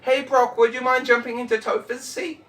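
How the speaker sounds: ordinary talk, nothing marked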